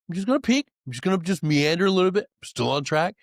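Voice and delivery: high, quick, innocent voice; justifying a cheat in a high sing-song; sneaky self-talk. Retold or imitated. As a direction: sell the little detour to yourself.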